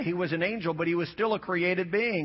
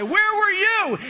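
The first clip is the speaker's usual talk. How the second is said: slow, much higher shouted WHERE; God's booming question; 11 semitones higher, 5 dB louder, slower than usual. Acted. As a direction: thunder it out as God to Job. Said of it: "He says".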